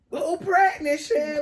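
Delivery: high, loud shocked question; loud, high disbelieving cry of a mother; shocked mom. Retold or imitated. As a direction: mom can't believe what she's reading. Mom shocked by pregnancy test.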